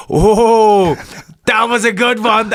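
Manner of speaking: high, loud, delighted oh; impressed surprise. Retold or imitated. Fighter retelling his punch to host, high delighted surprise. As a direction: marvel at the shot you just landed. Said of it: "you're like".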